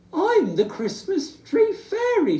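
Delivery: high, loud, proud little bear. Acted. Little Bear telling Rabbit, proud and high. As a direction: squeak it out proudly.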